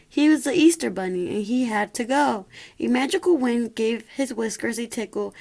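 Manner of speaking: ordinary delivery, nothing marked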